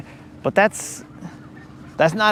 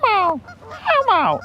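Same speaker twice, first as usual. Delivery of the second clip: high, weak puppet voice; Elmo voice; faster than usual. Pretend. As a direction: squeak out Elmo breathless. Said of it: "be like".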